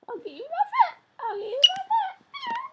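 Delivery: high, loud teasing singsong; mock threat in a squeaky voice; playful pet voice. Real, not acted. Owner teasing the pet she'll catch it, high playful voice.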